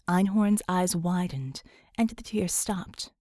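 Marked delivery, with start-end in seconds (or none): none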